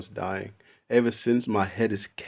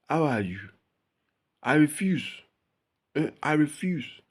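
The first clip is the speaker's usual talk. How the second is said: higher-pitched African accent on short phrases; 6 semitones higher than usual. Shown as a demonstration. Speaker demonstrating their old childhood accent to viewers, African accent. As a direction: put on a strong African accent. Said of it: "I had the African accent".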